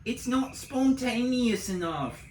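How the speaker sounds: put-on high, slow, whiny ex-girlfriend voice; mocking the horrible ex-girlfriend's high nagging whine. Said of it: "my horrible ex-girlfriend used to always be like".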